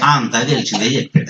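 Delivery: low-pitched offhand mock grumble; imitating unimpressed listeners. Listeners dismissing the visiting preacher's message, imitated unimpressed. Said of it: "అంటారు మీరు కూడా".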